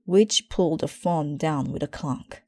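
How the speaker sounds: plain reading voice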